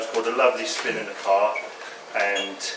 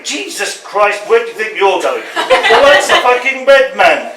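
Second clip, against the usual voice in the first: furious shout; 9 semitones higher, 9 dB louder than usual. Acted. Dad at the wheel yells at a cyclist, furious. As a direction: bellow at the cyclist running the light. Poem voices driver yelling at cyclist.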